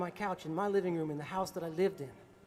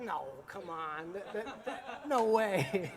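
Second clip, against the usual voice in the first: laughing disbelief; much slower than usual. Retold or imitated. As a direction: chuckle in disbelief. Can't believe he got punched.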